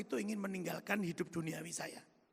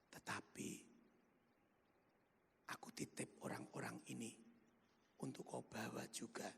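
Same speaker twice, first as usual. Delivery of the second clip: gentle voice of God; 9 semitones lower, 12 dB quieter than usual. Retold or imitated. God tenderly entrusts his employees to him, hushed and gentle. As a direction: speak as God, hushed and loving. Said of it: "lembut itu Tuhan katakan".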